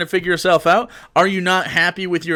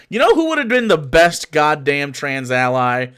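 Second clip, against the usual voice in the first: usual speaking voice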